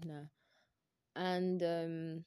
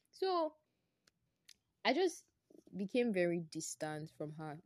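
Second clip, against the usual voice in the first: normal talking voice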